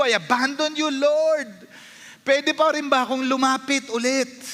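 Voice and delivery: high, loud anguished confession; condemned pleading. Acted. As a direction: beg God as a repeat sinner. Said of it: "if you're feeling condemned and you feel like".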